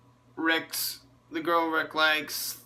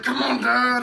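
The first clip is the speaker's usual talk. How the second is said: higher and louder mock call to dad; urgent plea; 7 semitones higher, 6 dB louder than usual. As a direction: hurry him along with a raised voice. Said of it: "Carl's just like".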